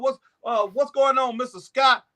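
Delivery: high, glad-handing mayor voice greeting voters by name; mock politician greeting. Imitating a mayor greeting families by name, high and chummy. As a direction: play a schmoozing mayor working the room. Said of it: "they greet these families".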